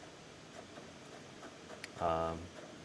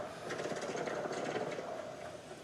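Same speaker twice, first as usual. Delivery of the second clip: breathy laugh; 13 dB quieter than usual. Real, not acted. Laughing at being hunted.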